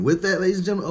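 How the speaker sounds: host closing the show; announcer. Acted. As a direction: present to the crowd. Host wrap-up.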